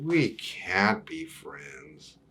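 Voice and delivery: slow, breathless whisper; a friendship ending in a hush; sad whisper. Acted. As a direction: whisper it sadly, slowly.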